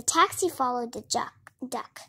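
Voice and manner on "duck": ordinary delivery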